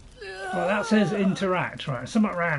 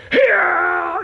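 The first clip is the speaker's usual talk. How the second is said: loud, much higher mock cheer; mock battle cry; 10 dB louder than usual. Gamer showing how a fighter should yell, loud mock cry. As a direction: belt it like a charging warrior. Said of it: "you'd be going".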